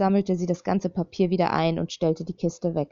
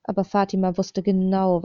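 usual speaking voice

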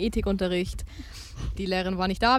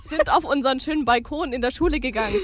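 normal talking voice

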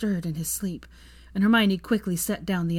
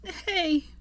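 higher, teary happy lilt; Hermione, light laugh; 12 semitones higher than usual. A narrator's reading. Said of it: "she laughed lightly".

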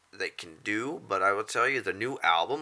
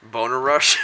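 ordinary talk, nothing marked

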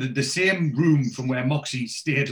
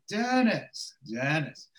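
Ali Fraser saying Dennis; 4 semitones higher, much slower than usual. Retold or imitated. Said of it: "I love the way that he says Dennis".

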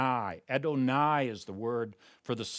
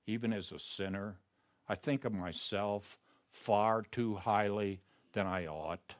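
soft, softer, intimate murmur to God; hushed prayer; 6 semitones lower, 6 dB quieter than usual. Shown as a demonstration. Preacher modelling his own private prayer, softly. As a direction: pray it quietly, as if alone before the throne.

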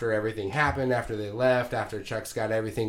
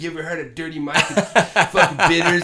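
quick, laughing imitation; laughing quote; 5 semitones higher, 6 dB louder, faster than usual. Imitating Mike's sales pitch while laughing.

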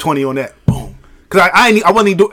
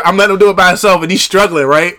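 ordinary talk, nothing marked

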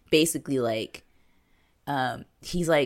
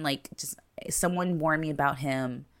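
whispered warning; 5 dB quieter than usual. Retold or imitated. Secret warning.

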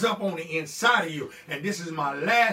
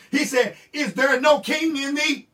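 loud, high, rising sermon cadence; preaching at full force; 3 semitones higher, 5 dB louder than usual. Acted. Pastor rousing the church, preaching loud and high. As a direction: thunder each question at the congregation.

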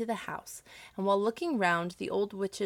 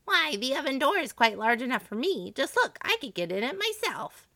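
high-pitched, scornful witch; impatient storybook witch, pitched up; exasperated old woman; 6 semitones higher, 3 dB louder than usual. A narrator's reading. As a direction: show her up in a high witchy voice. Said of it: "said the old woman".

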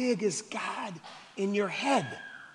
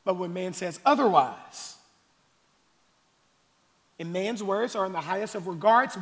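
ordinary talk

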